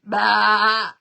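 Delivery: a mock sheep baa; sheep bleat. Host joking the neighbour is a sheep, mock bleat. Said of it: "It's a sheep".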